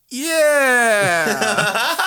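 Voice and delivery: high, loud, drawn-out cover-up; awkward agreement. Retold or imitated. Caught students answer passing teacher, awkward agreement.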